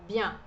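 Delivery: Bien with a nasal ending, like the Portuguese ão sound; Bien as bian, nasal; nasal French vowel. Shown as a demonstration. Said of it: "Esse E N é um som um pouquinho mais nasal". (Bien com som nasal)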